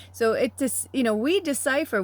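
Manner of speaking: ordinary delivery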